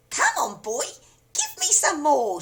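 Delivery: put-on very high, loud screechy old woman's yell; crone yell. A narrator's reading. Grandma demanding George's medicine, screechy yell. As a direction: yell it like a greedy hag. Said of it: "she yelled".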